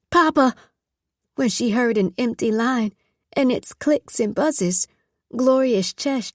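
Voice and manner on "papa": loud, high cry of a name; calling out. Acted. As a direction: shout for him down the dead line. Calling into a silent phone line.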